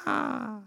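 high, soft "ah" of alarm; small, high-pitched mock wail; mock scared cry. Pastor mimics being helpless in a boat far out, mock scared cry. Acting out helpless fear at sea.

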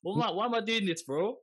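high panicked pleading. Retold or imitated. Panicked inner thoughts.

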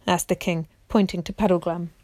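usual speaking voice

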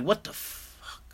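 slow, whispered disbelief; stunned whisper. Retold or imitated. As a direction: breathe it out in shock. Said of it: "I'm like".

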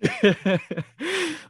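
high, helpless real laughter; laughing to tears. Real, not acted. Co-hosts losing it over Mary's comeback, helpless laughter. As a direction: laugh until you nearly cry. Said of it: "I'm about to cry".

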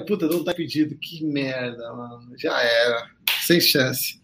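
deflated, voice sinking low and soft; dejected. Retold or imitated. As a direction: let the hope drain out quietly. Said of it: "Aí eu falei".

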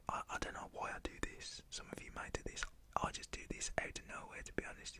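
ordinary delivery

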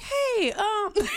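high put-on greeting, edged with laughter; polite greeting. Caller greeting the other woman, mock-polite and high. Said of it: "I s-, said it just like that".

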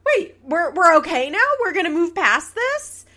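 high, loud, confused double-take; baffled disbelief. Retold or imitated. Host acting out her confusion as the couple makes up, high and loud. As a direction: ask it like the ground just shifted under you. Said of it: "I'm like".

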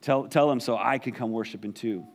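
a hint of a slithery, scheming snake. Shown as a demonstration. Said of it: "Little, little snake voice there if you want to insert that there".

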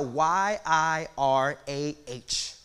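slow letter-by-letter spelling; slowly spelling "Y I R A H" for the congregation; spelled out slowly. Shown as a demonstration. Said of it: "but jirah".

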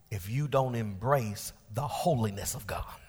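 hushed solemn aside; whispered warning. Real, not acted. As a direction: whisper the condition.

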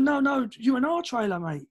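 insistent correcting. Retold or imitated. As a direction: set a drunk straight. Correcting a drunk intruder.

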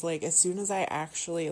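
ordinary talk

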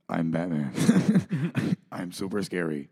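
low put-on Batman growl, breaking into a laugh; Batman impression. Host shows off his Batman voice to listeners, low and laughing. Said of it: "I'm gonna do my Batman accent, my Batman impression".

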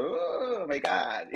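slow, high gasp of shock; hammed-up shocked boss. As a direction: drag it out in mock horror. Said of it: "she was".

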